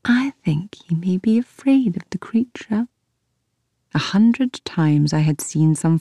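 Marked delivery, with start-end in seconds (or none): 0.0-2.9 breathy conspiratorial whisper. Acted. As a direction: lean in and whisper the secret. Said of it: "she lowered her voice to a whisper".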